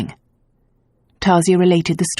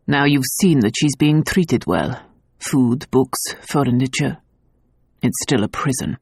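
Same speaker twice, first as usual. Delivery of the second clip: cold; 4 semitones lower than usual. A narrator's reading. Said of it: "Luke said coldly".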